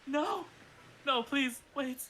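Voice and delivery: high frantic begging; pleading. Acted. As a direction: beg in a panic. Captive begging.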